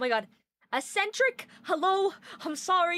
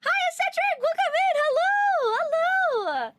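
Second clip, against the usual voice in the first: high, loud welcome call; excited greeting; 10 semitones higher, 3 dB louder than usual. Real, not acted. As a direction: greet a new viewer with joy. Greeting a viewer by name.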